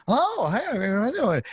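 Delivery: high, gushing hello; mock excited greeting. Manager greets supposed TV star at table.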